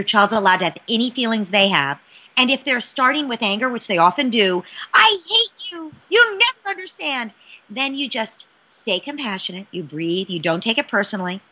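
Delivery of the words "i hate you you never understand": put-on angry child. Child's angry outburst voiced.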